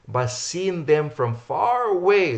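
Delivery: "far" drawn out long and high; stress on FAR AWAY. Real, not acted. Preacher reads the verse to listeners, stretching "far away". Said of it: "I wanna emphas".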